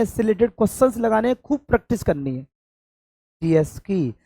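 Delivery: ordinary talk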